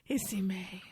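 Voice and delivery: breathy whisper, voiceless; whispered Pig Latin line. Acted. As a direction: whisper it like a lover. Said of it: "he delivers that line to me".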